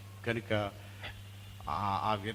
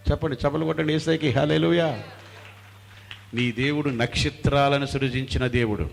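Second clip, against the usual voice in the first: loud, emphatic sermon delivery; rally the congregation with full voice; rousing preaching; 3 semitones higher, 7 dB louder than usual. Real, not acted.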